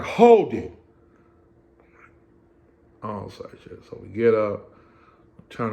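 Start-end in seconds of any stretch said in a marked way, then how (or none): 0.0-0.7 loud, sharp order from the guard; guard shout. Retold or imitated. Guard halting the inmate mid-search, loud shout. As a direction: stop him dead with a loud command.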